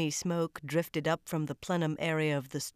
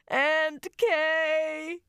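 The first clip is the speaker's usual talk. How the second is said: loud, much higher sobbing cry; 12 semitones higher, 6 dB louder than usual. A narrator's reading. Lucy crying out to her aunt, sobbing. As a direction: wail the name. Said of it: "She was crying so hard".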